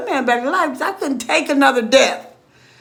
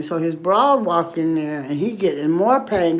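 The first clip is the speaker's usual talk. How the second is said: ordinary talk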